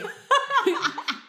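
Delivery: high laugh; real high laughing; burst of laughter. Real, not acted. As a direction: crack up. Laughing at cutting pony eyes.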